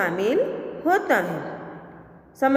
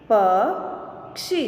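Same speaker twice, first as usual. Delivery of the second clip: slow, each sound drawn out, rising at the end; slow drawn-out reading; 3 semitones lower, slower than usual. Shown as a demonstration. Teacher slowly sounding out a word for students, drawn out. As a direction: stretch the word slowly for the class. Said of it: "अब इसका शब्द हम देखें".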